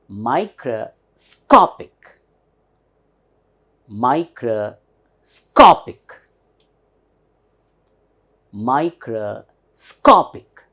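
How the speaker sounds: MICROSCOPIC very slowly, SCOP punched loud; slow "microscopic", big stress on the third syllable. Shown as a demonstration. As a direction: split "microscopic" into micro, then a hard SCOP-ic. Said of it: "with stress".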